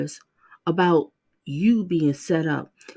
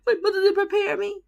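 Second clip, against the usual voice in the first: mocking whine; 11 semitones higher, faster than usual. Mocking the defendant's words.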